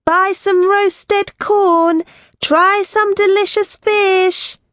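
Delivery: slow, high, sustained street-seller's cry; vendors' calling. A narrator's reading. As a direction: call out your wares in a drawn-out high voice. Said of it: "I heard vendors shouting".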